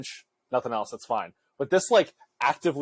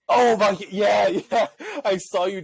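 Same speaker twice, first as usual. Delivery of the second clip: excited; 8 semitones higher, 4 dB louder than usual. Real, not acted. Shocked reaction to roommate.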